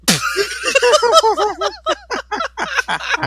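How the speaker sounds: big burst of shouting laughter; loud laughter. Real, not acted. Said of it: "You heard it here first".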